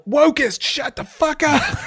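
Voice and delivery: loud, high furious yell; shouting down a taunting soldier. Acted. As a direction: scream it at him. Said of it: "And I also yell".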